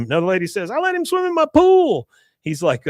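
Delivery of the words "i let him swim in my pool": very high mock outrage; hammed-up appalled woman, pitched very high; horrified. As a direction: wail it scandalized, voice way up. Another lady is horrified.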